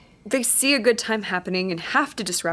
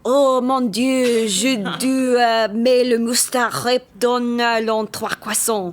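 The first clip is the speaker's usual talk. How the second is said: slow, loud hammy fake French; fake French gibberish; 5 dB louder, much slower than usual. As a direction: rant in mock French gibberish. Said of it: "French. Let's hear it".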